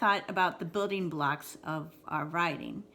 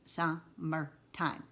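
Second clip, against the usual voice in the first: slowed to syllables; 3 semitones lower, 4 dB quieter, much slower than usual. Shown as a demonstration. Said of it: "three different ways as I'm saying".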